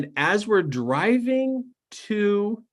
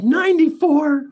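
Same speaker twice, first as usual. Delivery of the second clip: amazed disbelief; 11 semitones higher, 7 dB louder than usual. Retold or imitated. Amazed at the woman's age.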